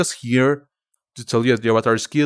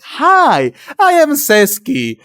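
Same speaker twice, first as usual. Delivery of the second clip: high, loud, overly eager self-intro; eager nervous hello; 12 semitones higher, 5 dB louder, much slower than usual. Retold or imitated. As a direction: force the cheer, a beat too bright. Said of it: "and I was just like".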